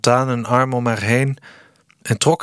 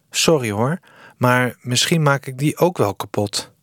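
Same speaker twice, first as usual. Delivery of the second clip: ordinary delivery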